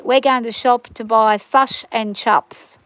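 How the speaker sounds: slow New Zealand accent, "fush and chops". Shown as a demonstration. Australian showing how New Zealanders order takeaway, imitated New Zealand accent. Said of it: "they pronounce their I like a uh".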